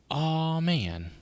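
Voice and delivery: loud, drawn-out "aw" starting high, then dropping; exaggerated letdown; dismayed groan. Real, not acted. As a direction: groan it out in open disappointment. Dismayed at the game's stat check.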